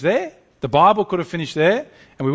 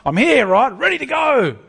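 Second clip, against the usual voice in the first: higher, louder swaggering hero boast; mighty warrior; 8 semitones higher, 4 dB louder than usual. Pretend. Preacher imagining the Lion as a massive warrior, boastful. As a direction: puff up like a champion. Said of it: "It's like".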